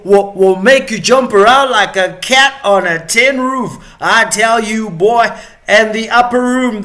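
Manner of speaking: hammed-up Southern accent, rising to a shout; American Southern accent. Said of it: "American Southern accent".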